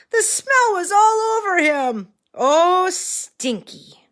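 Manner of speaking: high loud dismayed cry. A narrator's reading. Dismay at the smell.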